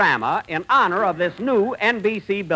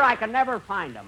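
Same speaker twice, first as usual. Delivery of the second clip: usual speaking voice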